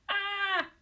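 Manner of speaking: high, loud yelp of pain; cry of pain. A narrator's reading. Wife crying out when hit on the head, a high yelp. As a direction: yelp as the door hits. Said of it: "Missus Warden cried".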